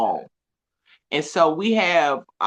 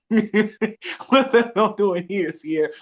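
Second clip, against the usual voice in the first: therapist quote, laughing; 3 semitones higher than usual. Retold or imitated. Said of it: "she be like".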